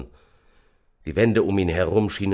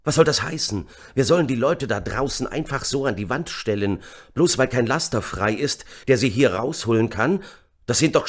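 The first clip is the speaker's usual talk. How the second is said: higher, agitated protest; outraged shouting; 6 semitones higher, 3 dB louder than usual. A narrator's reading. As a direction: protest loudly in disbelief. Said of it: "schrie Amir". (empört geschrien)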